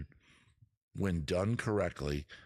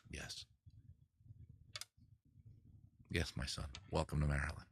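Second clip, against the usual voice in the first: low, much softer, priestly hush; solemn blessing; 7 semitones lower, 10 dB quieter than usual. Retold or imitated. Welcoming a friend to Maryland crab cakes, low, hushed mock-priestly blessing. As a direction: bestow it like a patriarch blessing his son. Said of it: "And I go".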